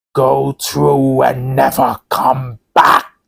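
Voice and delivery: slow, otherworldly warning; ghostly voice. Acted. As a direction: drag each word like a voice from beyond. Eerie unseen voice at the door.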